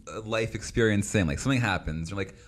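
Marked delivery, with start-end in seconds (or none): none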